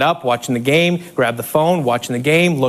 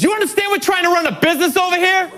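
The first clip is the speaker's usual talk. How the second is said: annoyed boss; 9 semitones higher, faster than usual. Retold or imitated. Marie angry, pissed.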